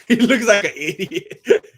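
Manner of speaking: breaking into laughter while talking; laughing in ridicule. Real, not acted. Streamer ridiculing that fool with the crown, laughing through it. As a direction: crack up as you say it. Cracks up at Canelo wearing the crown.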